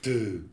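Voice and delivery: a low, put-off "Duh"; disgusted surprise. Shown as a demonstration. Lecturer demonstrating a disgusted surprise sound. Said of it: "that's the disgusted surprise".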